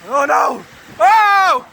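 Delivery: ordinary delivery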